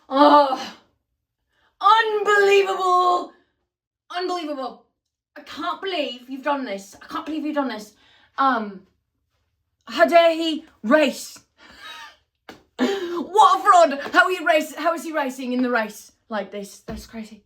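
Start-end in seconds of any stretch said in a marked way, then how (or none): 0.1-7.9 hammed-up British outrage, starting loud and easing off; terrible British accent. Mocking George Russell after every race, terrible British accent. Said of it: "Sorry for my terrible British accent". 9.9-16.8 high, loud mock-British indignation; terrible British accent. Mocking a driver's post-race complaints, terrible British accent. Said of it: "Sorry for my terrible British accent".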